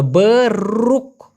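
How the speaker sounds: "বরুক" drawn out slowly, with a long rolled r and a clear k; উচ্চারণ দেখানো. Shown as a demonstration. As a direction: stretch the middle of "বরুক" and release the final k. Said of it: "উচ্চারণটা হলো". ("বরুক" টেনে, র লম্বা করে)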